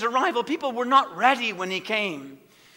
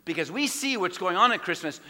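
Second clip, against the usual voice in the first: ordinary delivery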